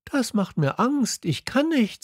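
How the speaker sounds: put-on a shy boy blushing; little prince to the king, high and bashful; embarrassed little prince. A narrator's reading. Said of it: "sagte der kleine Prinz und wurde rot".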